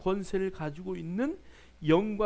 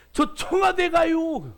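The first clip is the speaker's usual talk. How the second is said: proud excited announcement; 8 semitones higher, 6 dB louder, much slower than usual. Retold or imitated. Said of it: "목사님!".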